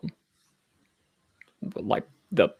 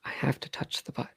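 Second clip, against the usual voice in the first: soft conspiratorial whisper; 8 dB quieter than usual. Pretend. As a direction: whisper it like a naughty secret. Joking whisper continues.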